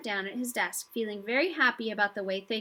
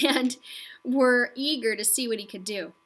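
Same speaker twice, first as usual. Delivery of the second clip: ordinary delivery, nothing marked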